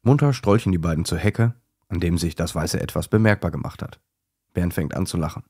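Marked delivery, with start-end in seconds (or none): none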